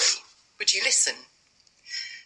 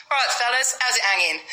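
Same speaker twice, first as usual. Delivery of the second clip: cheeky greeting; 6 semitones higher than usual. Acted. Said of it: "I know that's in poor taste".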